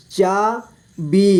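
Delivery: चाबी split slowly into चा, बी; syllable by syllable. Shown as a demonstration. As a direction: stretch and separate चा and बी in चाबी. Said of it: "इस तरह से आपको". (चाबी को तोड़कर धीरे: चा, बी)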